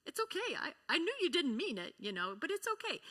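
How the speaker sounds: a mother forgiving her apologizing daughter; tender mom. Retold or imitated. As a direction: soothe a grown daughter kindly. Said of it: "I'm like".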